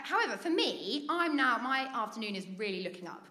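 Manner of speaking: ordinary talk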